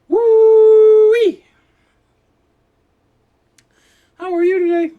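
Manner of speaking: very high, loud cartoon squeal; goofy falsetto. Vlogger greeting viewers in a loud goofy falsetto.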